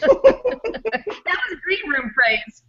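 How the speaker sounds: high, slow, broken up by laughter; helpless laughing. Real, not acted. Host to guest about backstage phrase, helpless laughing. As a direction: squeeze the words out between laughs.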